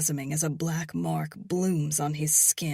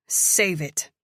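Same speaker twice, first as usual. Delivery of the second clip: cold; 6 dB louder than usual. A narrator's reading. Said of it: "My voice is cold, sharp".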